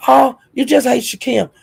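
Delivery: high, mocking quote of a critic; mock accuser. Quoting those who say she hates him, high mocking voice. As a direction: pitch it way up to mimic the accusation. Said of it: "Talking about".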